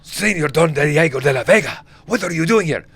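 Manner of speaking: high, loud mock Spanish greeting; a hammed-up Mexican local calling out to Don Diego; mock Spanish villager. Villager in the film greeting Don Diego in a mock Spanish voice. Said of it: "Whenever it's like".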